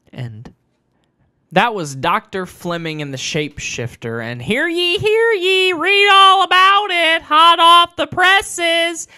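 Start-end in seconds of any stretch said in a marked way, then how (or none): 4.4-9.1 high, loud, slowing town crier call. Pretend. Author announcing his new story, loud and high like a crier. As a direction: proclaim it like a newsboy, drawn out.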